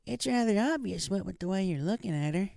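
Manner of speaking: put-on a kindly old grandma teasing gently; Granny. A narrator's reading. Granny voiced in story.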